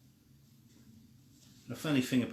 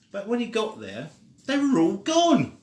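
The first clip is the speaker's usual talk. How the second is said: rising high and loud on the reveal; storyteller's big gasp of surprise; dramatic surprise; 9 semitones higher, 6 dB louder than usual. A narrator's reading. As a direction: build to a shocked gone.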